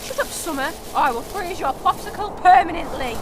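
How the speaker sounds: loud, menacing threat; angry threat. Acted. Winter threatening Summer across the table, angry and loud. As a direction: threaten him hotly.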